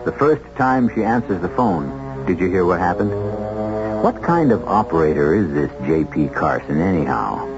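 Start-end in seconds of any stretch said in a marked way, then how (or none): none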